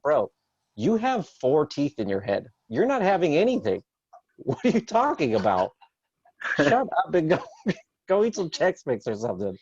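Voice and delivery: comic exasperated dad; mocking dismissal. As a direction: brush off the crying kid with mock scorn. Dad mocking crying son.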